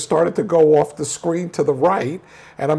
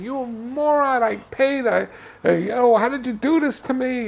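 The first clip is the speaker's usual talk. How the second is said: retold angry yell; 8 semitones higher than usual. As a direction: replay the outburst at the old projectionist. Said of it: "I am yelling at him".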